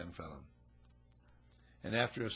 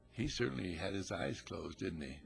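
usual speaking voice

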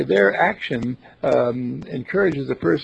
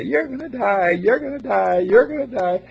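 nurse; 9 semitones higher, 4 dB louder than usual. Retold or imitated. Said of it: "the nurse going in to her patient and say".

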